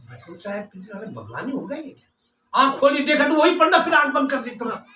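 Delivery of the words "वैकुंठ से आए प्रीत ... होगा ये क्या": very soft, quick murmur; hushed musing. Acted. Supposed corpse wondering if God came, hushed musing. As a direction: think aloud under the voice. Man murmurs to himself, hearing this.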